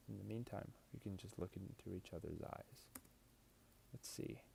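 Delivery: hushed, tender murmur; soft and intimate. Acted. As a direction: draw them in gently. Intimate lines, much softer.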